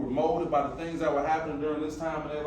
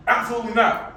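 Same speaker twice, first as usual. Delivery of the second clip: firm refusal; 5 semitones higher, 8 dB louder than usual. Retold or imitated. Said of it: "he stood on that".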